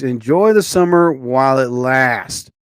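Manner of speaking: usual speaking voice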